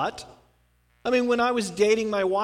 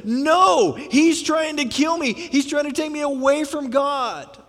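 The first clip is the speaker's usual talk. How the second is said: loud, high cry of refusal; the congregation shouting their refusal at Satan, loud and high; screamed out; 7 semitones higher, 6 dB louder, slower than usual. Shown as a demonstration. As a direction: shout it at the devil, pleading. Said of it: "We need to scream out and say".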